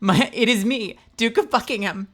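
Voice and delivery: high, loud, pompous mock duke; a hammed-up aristocrat greeting; Duke of Buckingham. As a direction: announce yourself like a grand duke. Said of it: "I love the impression of Buckingham".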